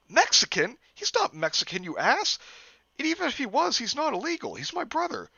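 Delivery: indignant Jeff. Acted. Jeff argues with cop.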